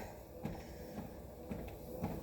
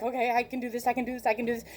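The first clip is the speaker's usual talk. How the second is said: soft self-pep talk; 2 semitones lower, 9 dB quieter, much faster than usual. Retold or imitated. Self-talk driving into storm.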